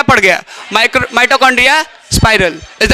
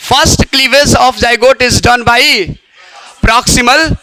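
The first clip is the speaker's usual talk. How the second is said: slow dictation; much slower than usual. Shown as a demonstration. Teacher dictating a note.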